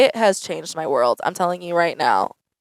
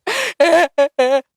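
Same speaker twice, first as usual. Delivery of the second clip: a burst of high laughter; real laugh; 9 semitones higher than usual. Real, not acted. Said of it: "And I was like".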